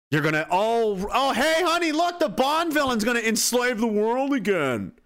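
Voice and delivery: high, slow mock-gasping; hammed-up naive "oh hey honey" voice; mock gullible spouse. Mocking a naive listener.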